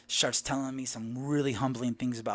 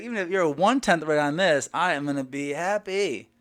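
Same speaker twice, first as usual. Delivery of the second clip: louder, higher, thrilled; excited reply; 6 semitones higher, 10 dB louder than usual. Retold or imitated. Telling the stranger he'll be happy, excited. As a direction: gush with excitement.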